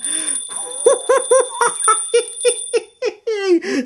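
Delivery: put-on high, squealing kid giggles; children's giggling. A narrator's reading. Flower man's kids tickled, high squealing giggles. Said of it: "Said his kids".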